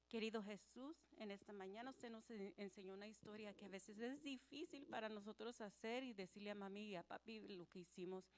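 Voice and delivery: soft, subdued prayer voice; hushed, simple prayer with little pauses to find the words. Real, not acted. Leads children in prayer.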